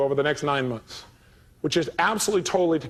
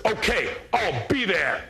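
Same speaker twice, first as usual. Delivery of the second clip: slow put-on voice of a huge caller; deep-voiced big man; 3 semitones higher, much slower than usual. Psychiatrist on the phone, imitated by the speaker in a slow put-on voice. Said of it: "a voice like this".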